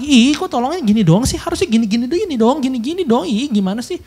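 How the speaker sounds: high, petulant complaining voice; ungrateful whining. Retold or imitated. Mimicking an ungrateful person who was helped. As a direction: whine like a spoiled helper-taker. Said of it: "dia malah minta lagi".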